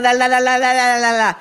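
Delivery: loud, high sing-song mock of the wife; hammed-up singsong. Mimicking his wife's chatter for the camera, loud sing-song. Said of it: "as she's going".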